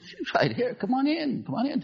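high, welcoming invitation; lightly voiced welcome at the door. Pastor welcomes worried mother.

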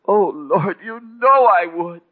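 high, loud and stretched out; reaching up to God with all her heart; eager plea. Retold or imitated. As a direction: cry out to the Lord in longing. Answering the voice from the cloud.

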